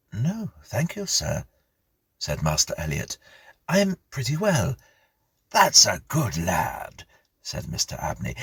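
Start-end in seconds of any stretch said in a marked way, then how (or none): 0.1-1.5 put-on higher, shy boy's voice; polite young boy. 3.7-4.8 put-on higher, shy boy's voice; polite young boy. 5.5-7.1 slow approving squire. Acted. Mr Abney voiced.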